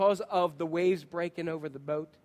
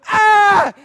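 sudden high yelp of panic; panicked cry; 12 semitones higher, 14 dB louder than usual. Acted. Fisherman yelping as the boat fills. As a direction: cry out as water floods in.

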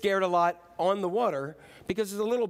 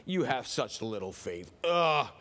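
low, slow mock disappointment with groans; groan, then drag it out low; hammed-up weary disgust; exasperated; 6 semitones lower, slower than usual. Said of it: "like this".

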